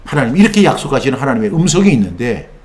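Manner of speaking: ordinary talk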